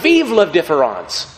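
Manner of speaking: slow, loud triumphant cry; crowd shout. Retold or imitated. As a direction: call it out like a cheering assembly. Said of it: "they all screamed out".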